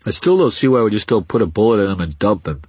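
loud, high harsh thug; harsh, denasal voice. A narrator's reading. Thug arguing to kill the captive, harsh and denasal. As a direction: blurt it harshly through a blocked nose. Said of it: "said the harsh voice with no nasal tone at all".

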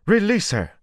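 high, loud demand; loud desperate order; shouted command. A narrator's reading. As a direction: shout it at him to let her go. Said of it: "the pretty woman cried".

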